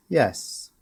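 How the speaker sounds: ordinary talk, nothing marked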